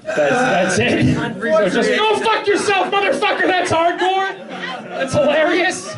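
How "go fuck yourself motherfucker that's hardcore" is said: squeaky high voice cursing, played for laughs; high-pitched joke voice. Comic doing a requested bit for friends, squeaky high voice. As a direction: pitch it way up and spit out the curses. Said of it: "I'll just name out jokes you want me to do".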